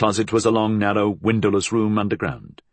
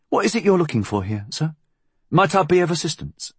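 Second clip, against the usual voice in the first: quick, higher-pitched, eager-to-help voice; deferential inspector; 8 semitones higher, faster than usual. Acted. Inspector offering assistance to his superior, deferential.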